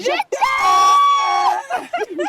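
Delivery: very high, long stretched yell; counting to two with his girlfriend, then screaming; joint shout. Pretend. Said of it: "On va crier ensemble".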